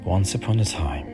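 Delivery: usual speaking voice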